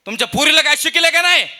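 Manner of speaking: loud high scolding; angry rant. Retold or imitated. Preacher scolds father-in-law over the phone, loud. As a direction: scold him for his daughter.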